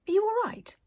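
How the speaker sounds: put-on small, high child's voice; a child asking, high and gentle; little voice. A narrator's reading. Little stranger calls to Arcadia, small high voice. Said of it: "by a little voice".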